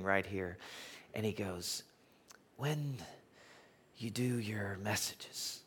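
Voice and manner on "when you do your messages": Nick Costas. Retold or imitated. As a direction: imitate a very soft, deliberate speaker hunting for words. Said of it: "only known in a Nick Costas kind of way".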